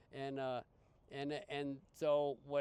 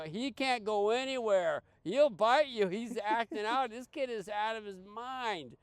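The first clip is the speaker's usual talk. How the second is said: high, loud worried warning; alarmed staff; 9 semitones higher, 9 dB louder than usual. Retold or imitated. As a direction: warn him off, alarmed. Staff warn against freeing kid.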